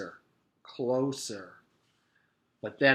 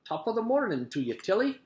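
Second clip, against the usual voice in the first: quick, high, lilting Scottish greeting; Scottish accent; 9 semitones higher, faster than usual. A narrator's reading. Long-silent man greets his wife, Scottish accent. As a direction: lilt it upward like a Scotsman. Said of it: "in a small village in Scotland".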